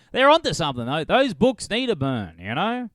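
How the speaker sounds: sarcastic high-pitched mock approval; high mock agreement. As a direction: voice jumps high and loud, sarcastic. Mocking the author's view.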